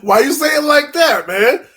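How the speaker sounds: high, loud, half-sung laughing shout; laughing outburst. Real, not acted. Host laughing at his co-host's pointed line, high excited shout. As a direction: crack up and call him out on it.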